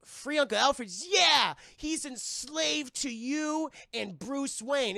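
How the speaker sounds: high, loud incredulous echo; mock disbelief. Robin questions Barbara, loud and incredulous. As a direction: repeat it like you can't believe it. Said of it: "Chris O'Donnell's like".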